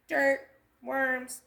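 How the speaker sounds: plain reading voice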